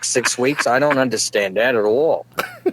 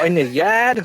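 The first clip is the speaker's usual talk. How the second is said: mock Boston "yard", pitched higher; Boston accent; 5 semitones higher than usual. Comic puzzling over how Bostonians talk, mocking Boston accent. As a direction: echo it back in a Boston accent. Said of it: "get the coat hanger out of your mouth".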